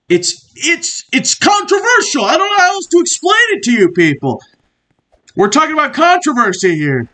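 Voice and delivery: high-pitched exasperated rant; mock heated rant. Comedy host ranting at his viewers, exasperated and high-pitched. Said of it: "I was getting a little heated".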